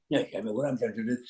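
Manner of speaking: fast, hushed Doctor retort. Acted. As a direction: hurry it under the breath as the Doctor. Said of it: "[name] was actually arguing with himself".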